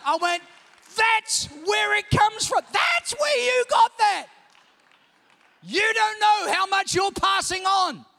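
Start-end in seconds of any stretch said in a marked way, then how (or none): 1.0-4.3 high, loud and slow, like a sudden discovery; preacher shouting his realisation to the church; excited shout. Retold or imitated. Realising where the habit came from. 5.6-8.1 loud, slow preacher's warning; preaching. Acted. Preaching at full force.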